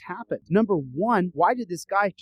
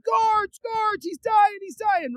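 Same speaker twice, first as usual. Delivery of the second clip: high, loud panicked shout; acted frantic scream; screaming for help; 4 dB louder, much slower than usual. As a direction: yell urgently for the guards. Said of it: "guys are screaming".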